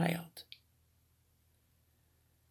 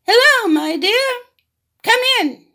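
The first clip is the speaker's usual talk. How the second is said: high, loud fake granny voice; wolf squeaks like a little old lady; wolf imitating grandma; 8 dB louder than usual. Wolf in bed fakes grandma's small voice, high. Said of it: "tried to imitate Grandma's small voice".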